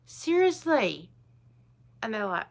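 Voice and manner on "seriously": high, slow disbelief; retold reply to a commenter, incredulous; incredulous seriously. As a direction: drag out a mock-incredulous seriously. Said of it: "And I go".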